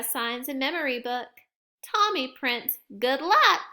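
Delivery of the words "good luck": put-on cheerful child. Child writes good luck.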